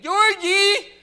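high, very loud mock yell; real loud. Pretending to be the impaired brother in the store, real loud. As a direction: holler it in a high put-on voice. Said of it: "real loud".

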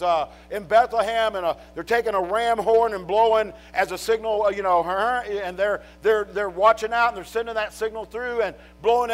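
loud, high, driving pulpit preaching; preaching at full force. Acted. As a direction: preach it hard to the congregation.

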